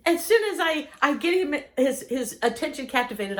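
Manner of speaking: normal talking voice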